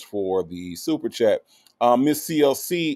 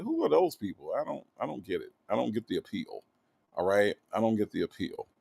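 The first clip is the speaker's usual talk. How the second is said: quiet dismissive; 4 semitones lower, 9 dB quieter than usual. Real, not acted.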